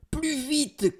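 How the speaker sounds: very high, urgent cry; panicked high-pitched urging; alarmed cry. A narrator's reading. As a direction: cry out to hurry from a pursuer. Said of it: "crie Valentine".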